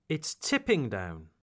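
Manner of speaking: accent lands on "tipping", falling after it; stress on tipping. Shown as a demonstration. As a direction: lean on "tipping", let "down" drop. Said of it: "imitate my intonation".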